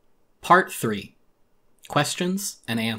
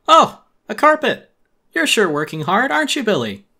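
much higher, loud cartoon voice; squeaky sarcastic neighbor; mocking villager; 10 semitones higher, 9 dB louder than usual. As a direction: tease him with fake cheer.

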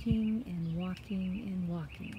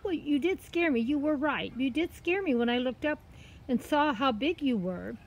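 put-on high girl's voice explaining herself; innocent story girl; 6 semitones higher, 4 dB louder than usual. Girl explains her fright to the cat, innocent. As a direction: pitch it high, earnest and innocent.

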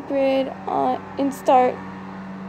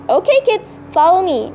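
usual speaking voice

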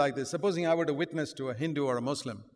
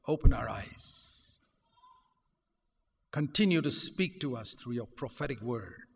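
closing prayer; 4 dB louder than usual. Acted. Pastor leading the church in prayer, hushed.

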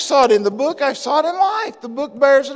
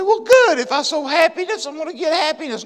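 raised-pitch imitation of eager reasoning; lightly mocking, higher-pitched retort; mock cheerful objector; 6 semitones higher, 3 dB louder, faster than usual. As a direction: play someone pleased with their own logic. Acts out listener's cheerful objection.

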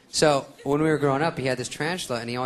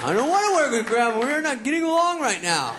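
put-on whiny teen; 5 dB louder than usual. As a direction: whine like a sulky teen. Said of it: "It's like".

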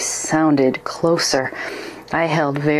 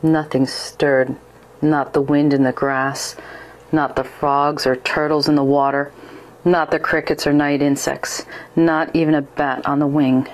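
ordinary delivery, nothing marked

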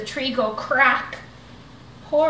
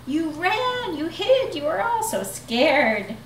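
teasing; 7 semitones higher than usual. Acted. Bonehead teasing friends.

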